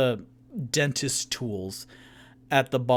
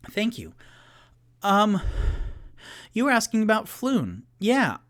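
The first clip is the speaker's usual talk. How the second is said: higher-pitched hesitant bartender; 6 semitones higher than usual. Acted. Bartender answering questions about Floon, higher and hesitant. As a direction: think it over in a lifted voice.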